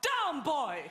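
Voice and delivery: loud barked command to a dog; demonstrated shout. Shown as a demonstration. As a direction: show how to shout the order. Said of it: "you've got to shout".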